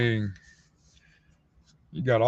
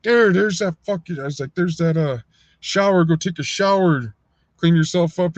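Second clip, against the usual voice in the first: barked order; 5 semitones higher, 4 dB louder than usual. Retold or imitated. Ordering beaten inmate to shower.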